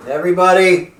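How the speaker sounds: loud call across the house. Real, not acted. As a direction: call everyone to dinner. Calling family to supper.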